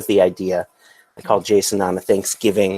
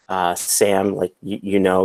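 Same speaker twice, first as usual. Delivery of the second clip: usual speaking voice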